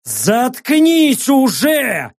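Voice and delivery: slow, high angry shout. A narrator's reading. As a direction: yell at him to shut up. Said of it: "заорал я".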